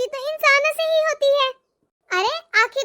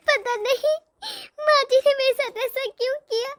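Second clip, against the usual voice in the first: usual speaking voice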